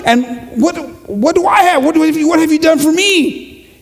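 high, indignant complaint that climbs to a shout; the jealous brother protesting to his father; resentful older brother. Acted. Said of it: "He goes".

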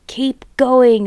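a lightly voiced farmer's shout; mild shout. A narrator's reading. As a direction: call out to the digger driver, understated. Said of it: "the fat Boggis shouted".

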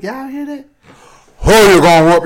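high teasing line then a very loud low threat; tease, then drop deep and loud; sassy deep threat. Retold or imitated. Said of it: "Broke they bros down".

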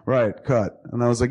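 usual speaking voice

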